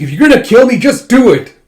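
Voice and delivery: high, loud taunt; defiant shout. Acted. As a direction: dare them to kill her. Defiant prisoner taunts soldiers.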